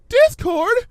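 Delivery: high, loud shocked exclamation; startled cry. Acted. Fluttershy recognising Discord over her shoulder, loud startled cry. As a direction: blurt the name in alarm.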